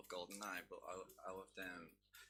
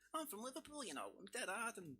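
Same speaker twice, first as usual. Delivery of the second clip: mock high-pitched fake Scouse accent; 12 semitones higher than usual. Liverpudlian guest mocking outsiders' fake high Scouse accent. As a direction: ham up a bad outsider's Scouse impression. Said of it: "they always do a high pitch".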